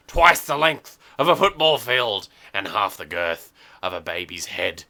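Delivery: usual speaking voice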